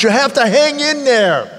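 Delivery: ordinary talk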